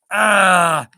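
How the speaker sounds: loud drawn-out "ah" of exasperation; exasperated groan. Real, not acted. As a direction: let out a big groan. Groans over shared mishaps.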